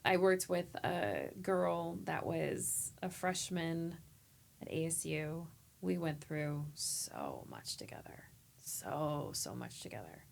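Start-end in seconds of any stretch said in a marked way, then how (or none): 5.8-10.2 near-whispered, choked, slow; real tearful hush; holding back tears. Real, not acted. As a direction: fight the tears as you say it. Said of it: "I don't wanna cry".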